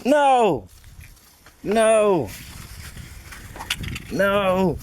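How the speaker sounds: high frightened cries of no; panicked, fending off a monkey; scared shouts. Real, not acted. Monkey grabbing his stuff.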